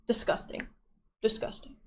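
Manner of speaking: soft unvoiced whisper of disgust. Real, not acted. Recalling a tarantula close-up to chat, whispered disgust. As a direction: breathe it out, repulsed.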